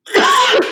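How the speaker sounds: sharp cough; a sudden hard cough. Real, not acted. Coughs again mid-story.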